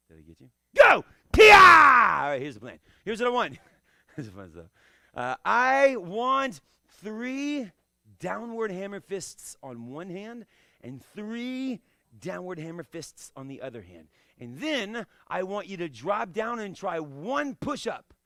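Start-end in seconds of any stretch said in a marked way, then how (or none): none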